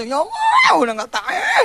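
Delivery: half-sung wail of someone at the end of their rope; crying out. Retold or imitated. A believer at his limit cries out to God, high-pitched wail. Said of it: "Sampai teriak gitu".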